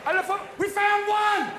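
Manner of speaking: very high, loud, drawn-out yell; alarmed shout. Acted. Olympic security spotting a suspect, alarmed screaming. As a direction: scream the discovery to everyone.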